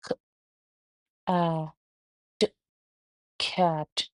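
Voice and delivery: ख, आ, ट, कैट sounded out slowly letter by letter; sounding out phonics. Shown as a demonstration. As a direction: break कैट into its sounds, then blend. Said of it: "तो इसको आप पढ़ेंगे". (ध्वनि अलग-अलग बोलकर कैट पढ़ना)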